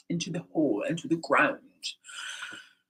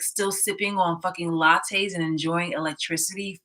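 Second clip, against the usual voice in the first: ordinary talk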